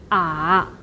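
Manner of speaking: 啊 low and dipping; third tone. Shown as a demonstration. Teacher reads the tones one by one, low dipping third tone. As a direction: drop 啊 low, then let it turn up slightly. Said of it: "the third tone is".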